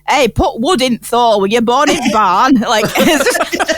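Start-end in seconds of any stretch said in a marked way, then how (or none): 0.1-2.5 imitated grumbling grandfather in broad northern English; mock northern English telling-off; northern granddad. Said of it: "our granddad Paul, who'd say things like".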